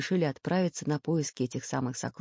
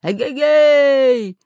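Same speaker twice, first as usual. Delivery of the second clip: long, high hailing call; booming drawn-out holler; thunderous shout; 9 semitones higher, 11 dB louder, much slower than usual. A narrator's reading. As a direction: bellow to someone far away. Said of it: "закричал громовым голосом Буль-Буль".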